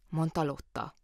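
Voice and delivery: ordinary delivery, nothing marked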